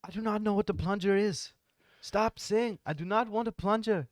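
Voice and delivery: high, loud mock yell in an Indian accent; a hammed-up Indian accent, shrill with rage. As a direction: imitate the angry Indian caller shouting. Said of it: "the Indian guy's yelling".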